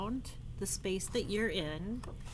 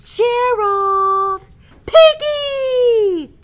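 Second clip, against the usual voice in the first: calling out names; 12 semitones higher, 16 dB louder, much slower than usual. Acted. Friends call each other's names.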